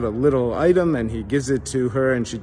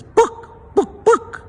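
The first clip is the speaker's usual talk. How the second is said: high squawked chicken voice; chicken cluck; 5 dB louder, much slower than usual. Acted. Chicken asks the librarian, high squawk. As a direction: cluck the word like a hen. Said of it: "The next day he comes in and he says".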